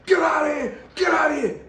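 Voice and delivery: high, loud shout to shoo it off; scream. Retold or imitated. Shooing a big rat away with a loud high yell. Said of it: "I screamed".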